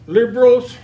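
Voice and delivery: a veteran fed up with the government snaps out Liberals loudly; angry outburst. Real, not acted. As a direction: bark out Liberals in real anger. Angry veteran at candidate forum.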